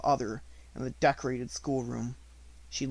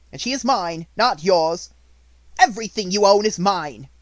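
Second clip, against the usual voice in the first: much louder, cold claim; domineering; 6 semitones higher, 12 dB louder than usual. A narrator's reading. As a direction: assert power harshly. Minchin claims everything.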